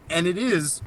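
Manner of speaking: usual speaking voice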